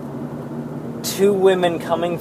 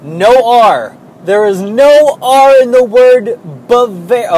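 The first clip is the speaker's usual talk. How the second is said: loud, raised-pitch pedantic correction; exasperated insistence; 5 semitones higher, 7 dB louder, slower than usual. Real, not acted. Worker ranting that people cannot pronounce Bavarian, exasperated. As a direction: hammer the point home, louder and higher.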